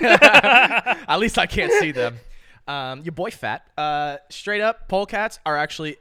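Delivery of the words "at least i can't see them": blurted out loud through a laugh, slowly. Real, not acted. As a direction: laugh it out at the awkward joke.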